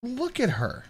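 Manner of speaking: high shocked disbelief; shocked squeal. Real, not acted. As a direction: squeal at what you see. Reacting to cringe video.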